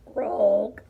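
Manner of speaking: loud imitated croak; one short throaty frog croak. A narrator's reading. Narrator voicing a frog suitor, a loud croak.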